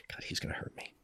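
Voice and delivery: hushed aside in mock dread; mock-fearful whisper. Student dreading the coach's reaction, mock-fearful whisper. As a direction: whisper it under your breath, playfully afraid.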